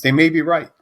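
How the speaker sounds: loud, high admission; emphatic concession. Real, not acted. Emphatic concession, then repeated low.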